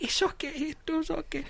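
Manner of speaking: tiny, high, soft voice; timid lady. Retold or imitated. Timid passenger forgiving the guy, meek and soft. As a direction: imitate a meek lady accepting an apology. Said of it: "very timid looking".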